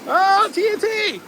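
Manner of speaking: high yelping panic on a ride; excited alarm. Real, not acted. Rider spotting TNT before the drop, high alarmed cries. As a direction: cry out high, ending in a scream.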